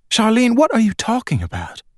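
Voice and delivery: high, startled mother's voice; mother stunned by her child's words; alarmed mother. A narrator's reading. Mother questions daughter's strange claim, high and startled.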